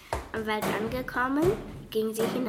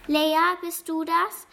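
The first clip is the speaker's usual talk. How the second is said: worried question; 7 semitones higher, 7 dB louder than usual. A narrator's reading. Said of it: "fragte Leonie besorgt".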